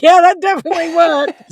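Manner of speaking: high, loud retold retort; exclaimed comeback. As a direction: re-enact firing back emphatically. Retelling her exclaimed comeback.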